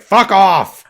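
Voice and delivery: loud, high acted yell; furious mock outburst. Queen answering Rose's question, furious mock shout. Queen snaps back at Rose.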